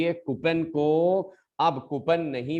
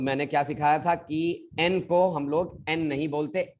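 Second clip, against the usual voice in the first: ordinary talk, nothing marked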